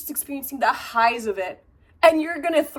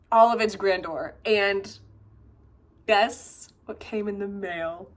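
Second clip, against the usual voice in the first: normal talking voice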